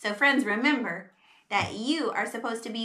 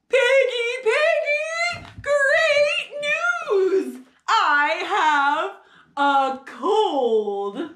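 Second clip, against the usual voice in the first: high, loud, drawn-out calling; jubilant announcement; 9 semitones higher, 6 dB louder, much slower than usual. Acted. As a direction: call out the great news with glee. Gerald joyfully calls out good news.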